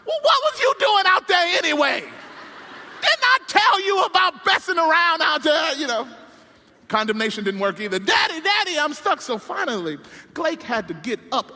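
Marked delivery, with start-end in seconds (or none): 0.0-5.7 high, loud, fast scolding; father blaming son, with laughter; scolding dad. Retold or imitated. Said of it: "tries to condemn him out". 8.0-9.2 put-on higher, a touch louder kid's voice; stuck son pleading with father; child calling. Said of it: "stuck kid calls again".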